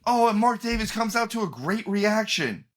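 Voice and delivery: high, gushing announcer impression; mock commentator gush. Mocking the commentator's hype, high gushing call. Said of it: "they're like".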